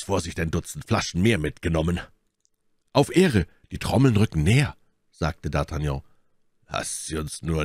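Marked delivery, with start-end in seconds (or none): 2.9-4.8 higher, quickening alert; urgent exclamation. A narrator's reading. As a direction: call out the approaching danger, pitched up. D'Artagnan alarmed by approaching drums.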